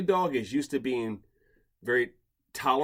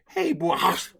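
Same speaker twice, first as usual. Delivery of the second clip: put-on drunk owner voice; 7 semitones higher than usual. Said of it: "When he said".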